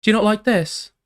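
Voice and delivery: put-on high girly voice; sing-song high-pitched girl. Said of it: "A little bit high-pitched".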